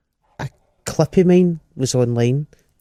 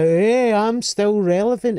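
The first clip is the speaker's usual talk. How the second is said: higher, cocky bloke voice; older bloke; 7 semitones higher, slower than usual. Retold or imitated. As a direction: puff up like a know-it-all man over fifty. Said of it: "It's just like".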